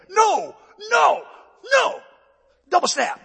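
high, loud mock kiai calls; imitated taekwondo class yelling; martial arts shout. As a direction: shout each word like a strike.

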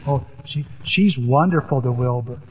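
soft, low, tender voice; endearment. Retold or imitated. Grandchild telling grandpa about the spider, tenderly. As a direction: imitate a child's fondness, hushed and low. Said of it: "you could just see the endearment".

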